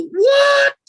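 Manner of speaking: a sudden high yelped what; startled shout. Retold or imitated. Startled man yelping at a creature in his window. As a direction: blurt it out in shock, way up high. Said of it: "I go".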